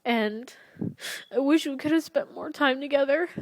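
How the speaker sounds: real crying, voice pitched high; tearful, very emotional. Real, not acted. Daughter apologizing to her late mother, real tearful emotion. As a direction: choke through it in a high, tearful voice. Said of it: "I'm getting very emotional".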